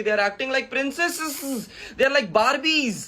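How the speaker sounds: high, drawn-out teasing; mocking. Creator compares rivals to Barbie princesses, high-pitched mockery. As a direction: stretch it out high like a mock princess.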